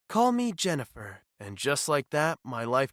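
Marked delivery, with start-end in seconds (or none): none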